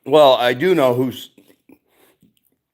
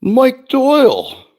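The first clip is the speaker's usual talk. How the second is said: drawn-out, much higher call of a name; excited greeting; 14 semitones higher, 5 dB louder, slower than usual. Real, not acted. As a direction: call out the viewer's name happily. Streamer greets chat viewer.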